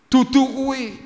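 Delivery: high, loud retort; imitated high-pitched objection; acted protest. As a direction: object at once, voice shooting up. Acts friend protesting.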